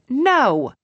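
high, loud protest; scolding refusal. Acted. May objecting to bacon joke, scolding. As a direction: object loudly, high then falling.

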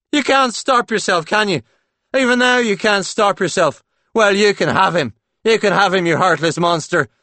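high, loud hammed-up fury; jealous tirade. Paul staging a break-up with Claude, loud jealous tirade. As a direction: build the fake break-up into a big scene. Climax of staged jealous row.

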